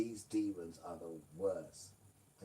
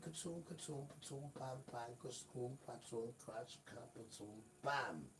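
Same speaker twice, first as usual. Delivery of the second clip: soft mouthed crash noises; tumbling sound effects; 7 dB quieter than usual. Retold or imitated. Adam falling off the mountain, quiet mouthed crash noises.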